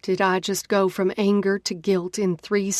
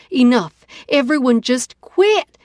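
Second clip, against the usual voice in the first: loud, much higher yell to stop the fight; 5 semitones higher, 7 dB louder than usual. A narrator's reading. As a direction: break up the chaos with a shout. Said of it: "I yell".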